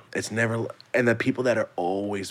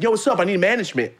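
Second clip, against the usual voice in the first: imitating pushy guy; 9 semitones higher, faster than usual. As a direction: play the guy, pitched up and brash. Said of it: "the guy said".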